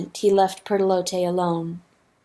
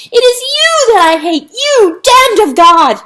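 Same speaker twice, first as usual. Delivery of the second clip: high, loud screamed curse; hateful screaming; 14 semitones higher, 12 dB louder than usual. A narrator's reading. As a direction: scream it with pure hatred. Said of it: "no goodness in the screaming now".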